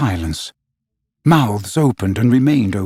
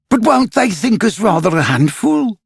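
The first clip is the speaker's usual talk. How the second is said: put-on higher boy's voice, eager and worried; a small boy asking while he bounces; Nibs asking mid-jump; 9 semitones higher than usual. A narrator's reading. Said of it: "Nibs asked in the middle of his jump".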